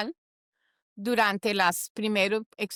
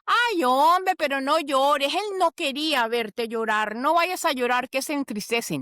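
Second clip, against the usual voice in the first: mock well-meaning comforter; 4 semitones higher than usual. Consoling a crying mourner, clumsy comforter, mock pleading. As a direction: parody a friend telling a mourner not to cry.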